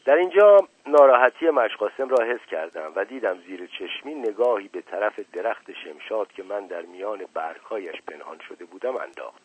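usual speaking voice

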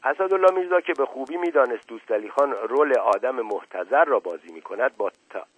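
plain reading voice

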